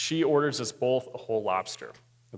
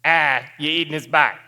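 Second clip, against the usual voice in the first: put-on Boston accent, called out louder; 2 semitones higher, 7 dB louder than usual. Stranger repeating himself to the diner, louder in Boston accent. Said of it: "now I hear a little more loudly".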